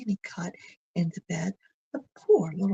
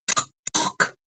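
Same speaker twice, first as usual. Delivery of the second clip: louder knocking; 9 dB louder than usual. A narrator's reading. Wolf knocks again at grandmother's door, much louder. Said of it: "He knocks louder".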